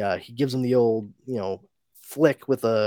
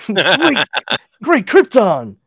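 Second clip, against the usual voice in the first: higher, louder hammed-up cry; theatrical comic-book gasp; mock exclamation; 9 semitones higher, 11 dB louder, slower than usual. Host answers the joke with a louder hammed-up cry.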